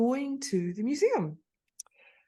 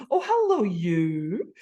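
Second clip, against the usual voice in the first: effusive greeting; 4 dB louder, slower than usual. Retold or imitated. Re-enacting her greeting to a stranger, effusively. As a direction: gush it as if delighted to see them. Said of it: "it was so effusive".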